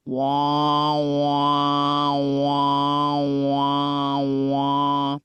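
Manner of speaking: loud droning wah wah wah; mock horror soundtrack wahs; wah sound effect. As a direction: hum a spooky film sting. Imitating eerie sound cue.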